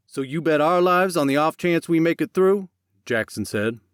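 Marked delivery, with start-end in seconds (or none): 0.1-2.7 accusing. Acted. Jackson accuses Max.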